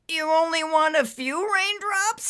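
high, squeaky question; small high squeaked voice. Acted. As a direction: squeak it in strained disbelief. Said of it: "he squeaked".

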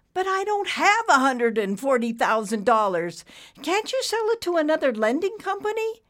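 very high, loud protest; pleading borrower. A narrator's reading. Borrower asking banker about his loan, pleading. Frank's character voice.

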